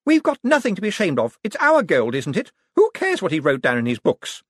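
high, indignant character voice; haughty, brazen woman. Acted. Sister defending the family's gold, brazen.

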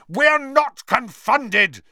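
high, slow furious roar. Acted. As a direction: roar it out, outraged. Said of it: "Harry roared".

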